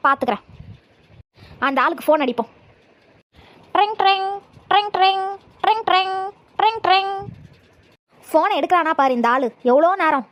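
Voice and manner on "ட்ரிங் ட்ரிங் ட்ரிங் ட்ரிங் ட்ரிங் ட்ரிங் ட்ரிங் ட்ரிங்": high mouthed ring-ring of a phone; phone ringing imitated. (வாயால் phone மணி ஒலி, உயர்ந்த குரலில்)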